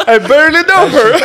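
loud high punchline yell; shouted pun over the music; joking shout. Real, not acted. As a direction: blurt the pun out fast and loud. Pun joke shouted over laughter.

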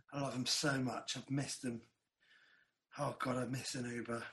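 wistful. Real, not acted. Wistful about missing Ubers.

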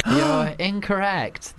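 high, drawn-out mock ruling; quiz host verdict. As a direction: stretch it out like a game show host. Quiz host reveals wrong answer.

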